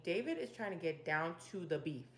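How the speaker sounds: ordinary delivery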